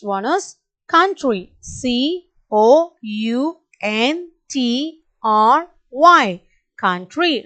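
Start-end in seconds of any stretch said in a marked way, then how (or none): 1.6-6.4 each letter slow and spaced; slow spelling. Shown as a demonstration. Teacher spelling slowly for the class. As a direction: spell slowly so kids can follow. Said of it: "Repeat along with me, children".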